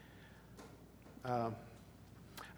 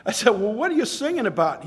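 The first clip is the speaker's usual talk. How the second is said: dismayed exclaim; 6 semitones higher, 6 dB louder than usual. Retold or imitated. Retold dismay at friend's news.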